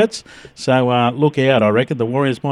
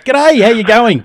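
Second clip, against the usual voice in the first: hearty greeting; 8 semitones higher, 6 dB louder, slower than usual. Real, not acted. Radio host greeting guest.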